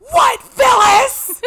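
hammed-up shriek, very high and drawn out; alarmed shout. Aunt turns around to Phyllis in the car, shrieking. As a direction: scream back at Phyllis in mock alarm. Aunt's alarmed reply, acted out.

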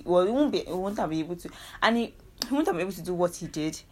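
ordinary talk

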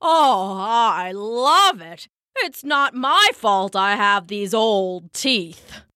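put-on slow, drawn-out old-man protest; defensive Papa. A narrator's reading. Papa assures Mama he loves her cooking, defensive. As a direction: protest slowly as flustered Papa.

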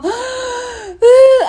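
high, crying gasp for air; sobbing gasp. Retold or imitated. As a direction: gasp like a child in a tantrum. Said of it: "así lloro, así como". (sollozo ahogado e imitado)

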